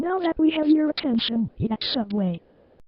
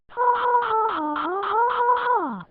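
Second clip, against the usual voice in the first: laughing on cue; 7 semitones higher than usual. Acted. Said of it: "then laugh at me".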